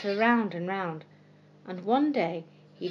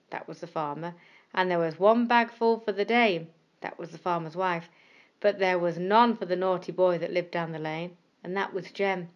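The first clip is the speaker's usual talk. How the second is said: plain reading voice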